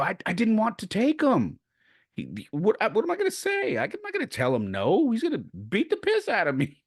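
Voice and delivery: high, flustered retold self-defence; defensive protest. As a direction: plead helplessly in a squealing high voice. Acted defence to aunt.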